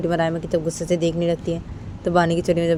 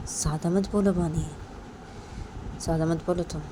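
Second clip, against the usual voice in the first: soft, restrained reprimand; quiet warning; 10 dB quieter than usual. Acted. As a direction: warn her under control, pausing between orders. Stern warning after slapping Bani. (धीमी आवाज़ में चेतावनी)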